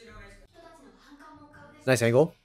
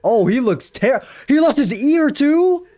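shocked outcry; 11 semitones higher, 5 dB louder than usual. Real, not acted. Shocked at injury.

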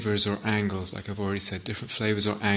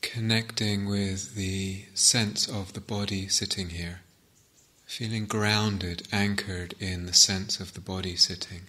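unhurried, softer phrases left to settle in silence; guided meditation; 6 dB quieter than usual. Acted.

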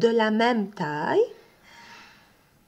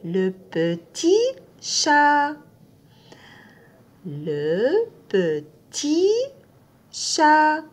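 "Le petit chat" slowly, syllable by syllable; drawn out for learners to copy; slow model reading; 4 semitones higher, much slower than usual. Shown as a demonstration. Teacher repeats the phrase slowly for the class. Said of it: "Vous voyez le I?".

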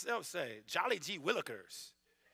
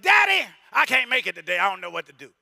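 pleading to dad; 15 dB louder than usual. Pretend. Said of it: "for me, it's just like".

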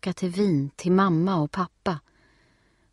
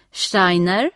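loud, questioning call to the crowd; lady repeating the name, loud and questioning; 4 semitones higher, 8 dB louder than usual. A narrator's reading. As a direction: raise your voice to find the parents. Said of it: "upprepar damen med hög röst".

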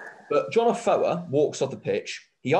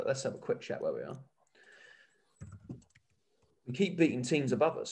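ordinary talk

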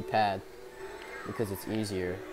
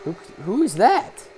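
much higher, louder surprised question; sudden startled squeak; 10 semitones higher, 7 dB louder than usual. Real, not acted. Spots unknown racer.

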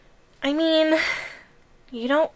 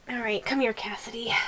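whisper; 3 semitones lower, 10 dB quieter, faster than usual. Acted. Coaxing the tiny captive.

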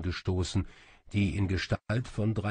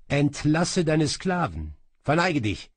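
higher, louder royal order; imperious command; 7 semitones higher, 5 dB louder than usual. A narrator's reading. King orders sorcerer to dismiss his slaves, imperious. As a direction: command him with kingly force.

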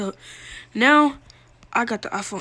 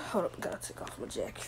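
muttered aside; 4 semitones lower, 16 dB quieter than usual. Real, not acted. Aside while removing jacket.

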